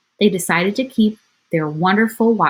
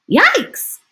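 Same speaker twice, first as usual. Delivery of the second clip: high squeaky yikes, much higher; startled yelp; 5 dB louder than usual. Acted. Burglar spots the dog, high frightened yelp. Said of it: "whispered one burglar".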